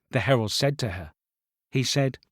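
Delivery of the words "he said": usual speaking voice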